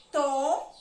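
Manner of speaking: loud, separated syllable To; teacher counting syllables in outono; syllable clapped out. Shown as a demonstration. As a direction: punch out To as its own beat. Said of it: "Eu vou marcar a sílaba".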